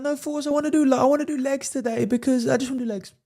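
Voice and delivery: put-on high, whiny girlfriend voice. Girlfriend whining to Force about leg day in a high whiny voice. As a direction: whine it like a pouting girlfriend, high up. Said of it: "She's like".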